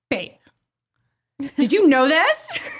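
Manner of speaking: high, slow, loud disbelieving question; wife asking husband in high disbelief; incredulous to husband. Retold or imitated. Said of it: "I'm like".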